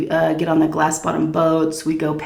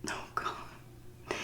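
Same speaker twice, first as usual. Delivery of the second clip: breathy whisper under the breath; 24 dB quieter than usual. Real, not acted. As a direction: whisper it to yourself. Overwhelmed recalling awkward memory.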